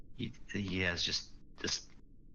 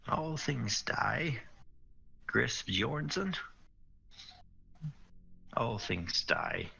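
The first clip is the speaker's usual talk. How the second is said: slow, grave voice of a dwarf; solemn dwarf; 3 semitones higher, slower than usual. Acted. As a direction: speak it slowly like a weary warrior. Mim solemn in character.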